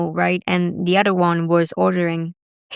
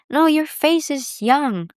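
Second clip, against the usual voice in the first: high, slow insistence; 8 semitones higher, slower than usual. Retold or imitated.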